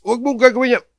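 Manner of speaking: sharp high scolding, half-shouted; scolding a toddler. Retold or imitated. Father scolding his toddler over the electric plug, sharp and high. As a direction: scold the child for touching the plug. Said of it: "may kasamang sermon".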